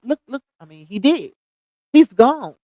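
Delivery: normal talking voice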